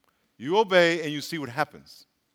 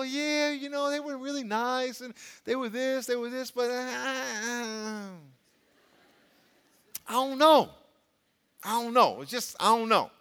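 hesitant unsure mumble; 5 semitones higher than usual. Retold or imitated. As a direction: sound lost and unsure. Mimicking unsure churchgoer.

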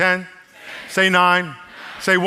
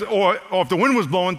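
ordinary delivery

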